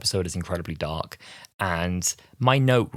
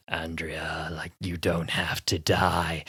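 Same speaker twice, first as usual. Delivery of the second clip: sinister tempter; 2 semitones lower, slower than usual. Retold or imitated. Trickster tempting Andrea.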